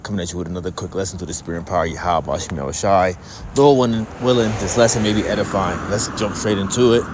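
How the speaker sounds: low, measured preacher's opening blessing; formal sermon greeting. Acted. Preacher greeting listeners before his lesson, low and formal.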